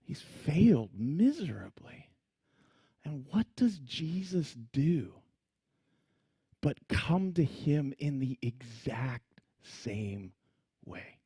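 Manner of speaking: slow, hushed, whispered reflection; quiet, grave hush over Peter's failure; solemn whisper. Real, not acted. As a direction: lean in and whisper it. Preacher drops to solemn whisper.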